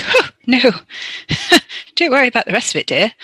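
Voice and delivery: hammed-up prim Mina.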